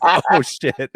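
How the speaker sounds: high, loud, laughing oh shit. Retold or imitated. Coach hears about the poop, laughing high and loud. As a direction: crack up as the coach. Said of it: "And he's like".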